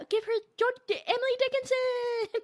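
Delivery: very high, squealed mock acclaim; mock gushing. Host jokingly crowns the writer a great poet, mock gushing. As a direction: send the name soaring up high. Acts out own over-the-top verdict.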